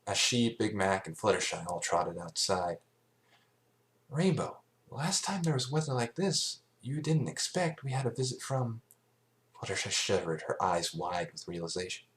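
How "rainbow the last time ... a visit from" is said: higher, uneasy pony voice; a dawning worry; worried Twilight. A narrator's reading. Twilight warning Rainbow about strange weather, uneasy. Twilight recalls Discord's storm.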